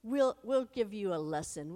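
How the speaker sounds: loud, high jeering shout; taunting shout. Acted. Philistines loudly jeer down at Jonathan, high. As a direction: jeer at them from the hilltop. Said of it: "they shout to each other".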